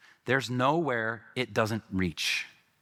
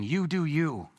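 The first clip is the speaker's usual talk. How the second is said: mock cheering crowd; 5 semitones higher, slower than usual. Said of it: "cheering each other on".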